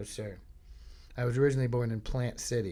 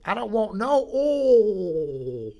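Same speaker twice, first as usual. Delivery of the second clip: high, loud, very drawn-out Southern "oil" in one syllable; deep South drawl; 9 semitones higher, 9 dB louder, much slower than usual. Retold or imitated. Customer repeating the order to the clerk, loud Deep South drawl. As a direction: holler it slowly in a Deep South accent, stretching OIL. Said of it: "That's two syllables".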